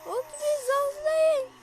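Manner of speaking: surprised. Real, not acted. Surprised at odd car name.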